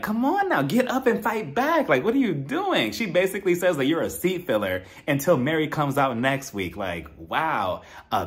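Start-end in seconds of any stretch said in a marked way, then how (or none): 0.0-2.9 urging on. Retold or imitated. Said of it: "I'm just looking at Angie like".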